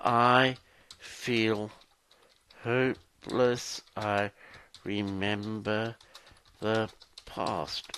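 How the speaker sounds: slow, halting, word by word; dictating while typing. Shown as a demonstration. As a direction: say it piecemeal as if typing each word. Speaks words while typing them in.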